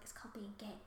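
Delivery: very soft, low aside; murmured under the breath; retold whisper. Student answering the teacher in class, retold whisper. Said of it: "I just whispered".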